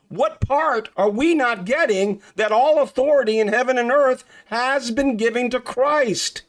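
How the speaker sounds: high-pitched, fervent sermon delivery; indignant preacher pressing every phrase home; impassioned preaching. Real, not acted. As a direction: preach it with rising and falling emphatic cries. Preaches at full force.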